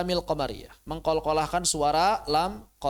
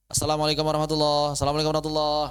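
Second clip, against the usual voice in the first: clipped fast syllables; rushed salam; 3 dB louder, much faster than usual. Shown as a demonstration. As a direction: race through it the wrong way. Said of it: "Aduh, bahaya". (salam terburu-buru yang salah)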